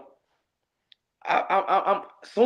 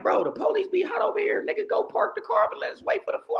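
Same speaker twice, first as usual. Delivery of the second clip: exasperated high plea; 14 semitones higher than usual. Retold or imitated. Annoyed at friend's driving.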